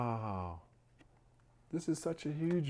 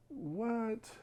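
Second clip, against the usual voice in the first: ordinary delivery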